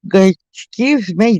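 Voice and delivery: words stretched through a yawn. Real, not acted. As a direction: talk mid-yawn. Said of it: "damn, yawning in your ear".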